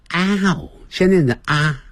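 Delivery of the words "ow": "ow" opened wide from ah then closing; ow sound. Shown as a demonstration. Teacher showing students the ow sound in down. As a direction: start on ah and round into "ow". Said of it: "那个念成ow".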